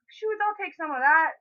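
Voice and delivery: eager yes. Acted. Friend offered food, accepting with loud eager pitch. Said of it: "And then you could be like".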